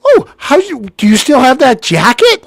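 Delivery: put-on high, loud gushing girl voice; excited girl. Girl from the Wang asking about the jacket, high and gushing. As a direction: gush like a thrilled young woman. Imitating the girl's excited question.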